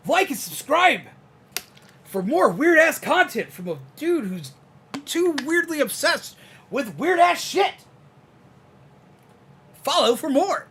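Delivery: high, loud hyped-up YouTuber outro. Pretend. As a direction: sell it like a YouTuber signing off. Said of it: "We've even learned the YouTuber voice".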